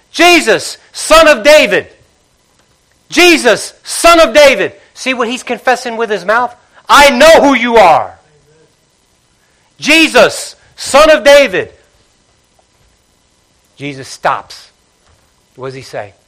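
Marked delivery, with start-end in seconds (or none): 0.1-4.7 high, loud pleading yell; yelling louder. Retold or imitated. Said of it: "he yelled even the louder". 6.9-11.7 loud, high confession shouted; crying out. Retold or imitated. Blind man keeps calling out.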